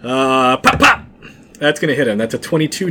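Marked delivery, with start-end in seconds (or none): 0.6-1.0 shot sound effect. Pretend. Mimics bow shots.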